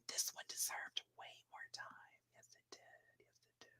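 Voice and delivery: barely audible whisper; hushed whisper, fading to almost nothing. Real, not acted. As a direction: whisper it as a private aside. Same remark repeated under the breath.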